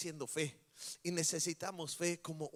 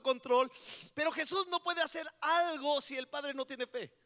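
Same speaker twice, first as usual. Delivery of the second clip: higher, louder sermon voice telling the story; full-force preaching; 7 semitones higher, 5 dB louder than usual. Acted. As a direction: preach it out with fire. Preaching the father's despair.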